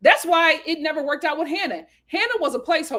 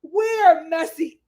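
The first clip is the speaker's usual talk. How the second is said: savoring drama; 3 semitones higher, 4 dB louder, much slower than usual. Real, not acted. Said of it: "I said".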